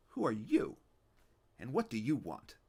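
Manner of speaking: put-on crusty old man demanding answers; gruff old man. Old man Fischel questions Stanley.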